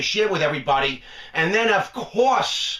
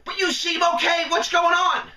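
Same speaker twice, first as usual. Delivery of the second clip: father-in-law; 10 semitones higher than usual. Retold or imitated. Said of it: "He says".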